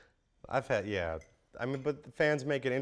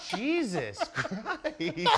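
stunned; much slower than usual. Retold or imitated. Stunned reaction to kid.

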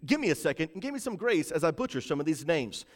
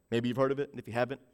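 normal talking voice

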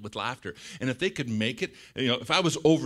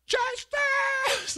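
very high, stretched mimicked scream; hammed-up high rallying cry; protesters demanding justice; 4 dB louder than usual. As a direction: shriek it like a fired-up protester. Voices Ottawa friends demanding justice.

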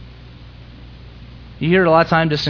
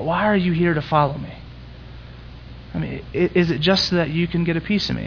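softer, gentle imagined Jesus; soft inner voice; 7 dB quieter than usual. Retold or imitated. Jesus quietly tells the crowd they're missing it, soft voice. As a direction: say it softly, almost to yourself.

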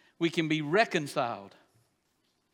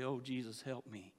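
quiet plea; 8 semitones lower, 15 dB quieter than usual. Retold or imitated. Voicing a prayer for help.

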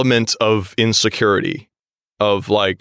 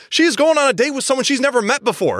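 high-pitched indignant rant; exasperated disbelief; 10 semitones higher, faster than usual. Real, not acted. Woman defending nervous daters, exasperated. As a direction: argue it as if it were absurdly obvious. Ranting at the man's remark.

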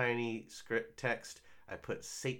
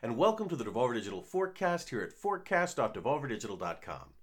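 announcer voice; 6 semitones higher, 6 dB louder than usual. Acted. Show intro announcement.

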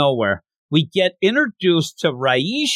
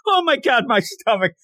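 mock panic; 8 semitones higher than usual. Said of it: "he just freaks out and has tummy problems".

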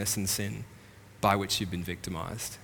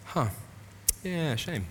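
mock shrug; 5 semitones higher, 4 dB quieter, slower than usual. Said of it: "is to kick back, wink, and go".

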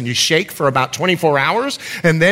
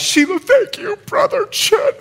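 much higher, tearful grateful woman's voice; 3 dB louder than usual. Retold or imitated. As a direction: play her thankful, close to tears. Grateful African woman voiced in story.

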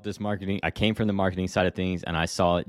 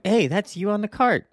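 much higher, louder excited stranger; mock-excited recognition, pitched way up; imitating a shopper; 13 semitones higher, 4 dB louder than usual. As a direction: play a shopper who spots the face on the cart.

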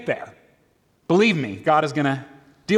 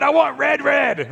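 archaic caveman shout; 8 semitones higher, slower than usual. Retold or imitated. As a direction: bellow it like a hungry caveman. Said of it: "this sort of archaic".

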